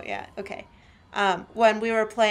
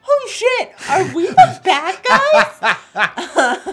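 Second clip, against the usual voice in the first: shouted realization; 12 semitones higher, 11 dB louder, much slower than usual. Retold or imitated. Mock realization, laughing.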